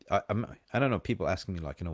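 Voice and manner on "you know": ordinary talk, nothing marked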